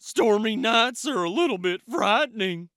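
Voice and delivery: a jittery goose admitting fear; high goose voice; nervous goose. A narrator's reading. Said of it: "said Goose".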